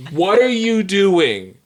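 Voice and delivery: retold freak-out, high and drawn out; freaked-out demand. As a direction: slowly stretch it out in appalled disbelief. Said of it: "And I freaked out".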